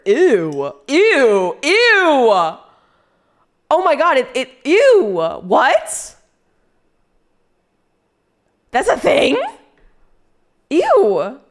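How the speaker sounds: high, loud, grossed-out squeals; loud disgust. Real, not acted. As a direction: squeal in appalled disbelief. Disgusted by mint flavored cigarettes.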